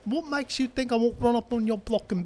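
put-on high London street accent; mock threat in a high London roadman voice; mandem accent. Friend boasting in the Top Boy bit, high London accent. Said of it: "I'm man them".

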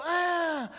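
very high, loud surprised exclamation; a sharp high ah of recognition; sudden realization. Retold or imitated. Old man realizing who the grandfather was, high surprised ah.

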